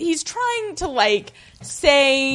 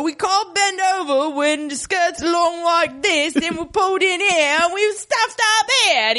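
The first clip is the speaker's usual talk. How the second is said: imitating her broad London accent, a bit higher; a mild mock of her London accent, slightly raised; Miss Ellis's accent; 3 semitones higher than usual. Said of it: "it's like [name] doing an impression of [name]".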